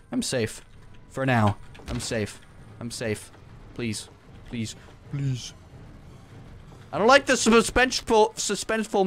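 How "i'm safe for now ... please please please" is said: softer, lower nervous pleading; hushed pleading. Real, not acted. Gamer hiding from monsters on his tower, hushed pleading. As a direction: plead under your breath.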